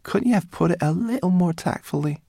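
high, peevish grumble; sarcastic, high-pitched complaint; muttered reproach. A narrator's reading. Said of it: "she muttered".

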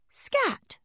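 very high little shout; tiny doll voice. Acted. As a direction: shoo the cat in a tiny voice. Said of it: "she called in her tiny doll voice".

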